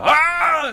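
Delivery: high, loud scream of pain. Retold or imitated. Marcus in pain, loud high scream. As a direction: scream like you've been hit. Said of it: "is actually from the game".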